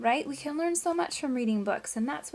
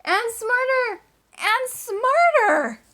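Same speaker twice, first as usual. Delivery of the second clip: each smarter higher, louder and slower; building excitement; 12 semitones higher, 9 dB louder, much slower than usual. A narrator's reading. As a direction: stretch it up like he's growing.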